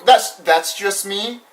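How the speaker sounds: ordinary delivery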